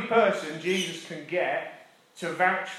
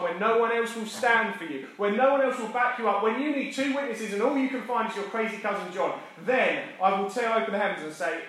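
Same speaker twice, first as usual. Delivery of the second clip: higher and building passion; rising preaching; 4 semitones higher than usual. Acted. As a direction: build towards the promise.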